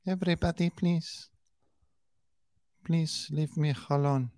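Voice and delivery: high, soft mock Spanish accent; mocking Spanish-accented plea, high and quiet; [name]'s fake Spanish accent. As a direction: plead softly in a put-on Spanish accent. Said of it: "the fake Spanish accent".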